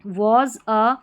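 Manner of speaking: Shopkeeper said slowly and fully; slow model word. Shown as a demonstration. Teacher sounding out shopkeeper for beginners, slow. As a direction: draw out shopkeeper syllable by syllable. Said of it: "इसको shopkeeper पढ़ा जाता है".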